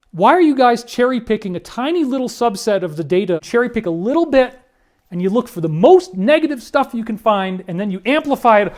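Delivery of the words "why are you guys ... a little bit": ordinary delivery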